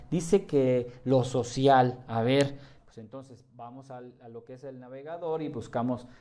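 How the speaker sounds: usual speaking voice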